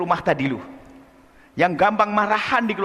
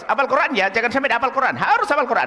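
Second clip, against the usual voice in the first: harsh demanding mother; 7 semitones higher than usual. Retold or imitated. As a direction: press the child hard to memorize. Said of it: "Uminya menggaya keras".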